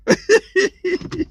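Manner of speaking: a long high laugh; high real laughter. Real, not acted. The speaker cracks up telling the stop-sign story, laughing. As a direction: burst out laughing.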